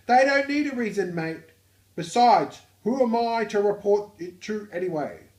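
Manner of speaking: loud, high-pitched Australian; Australian accent. Acted. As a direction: belt it out in a broad, hammed-up Aussie accent. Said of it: "the work known as the Australian accent".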